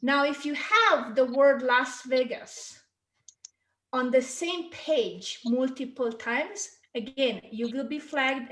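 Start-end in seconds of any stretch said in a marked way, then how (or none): none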